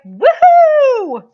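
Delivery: high, loud celebratory "woohoo"; jubilant whoop. Acted. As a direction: whoop it out at full volume, holding the note. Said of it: "there's a part of me that's like".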